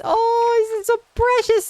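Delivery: cooing delight. Real, not acted. Cooing over baby foxes.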